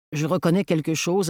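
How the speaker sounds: ordinary delivery, nothing marked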